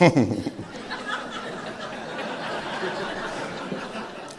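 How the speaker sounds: long airy unvoiced laugh; long breathy laugh. Real, not acted. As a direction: let a silent laugh roll on. Laugh after punchline.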